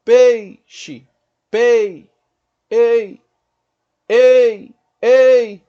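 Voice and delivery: "ei" bem forte e agudo, caindo no I; ditongo decrescente. Shown as a demonstration. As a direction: ataque o E de "pei" e deixe o I sumir. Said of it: "a vogal mais forte vai pra vogal mais fraca". ("ei" do forte pro fraco)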